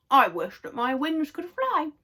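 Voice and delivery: wishing with cake in mouth; mouth full. Acted. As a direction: say it while munching. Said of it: "as she munched her cake".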